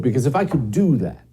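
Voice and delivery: ordinary talk, nothing marked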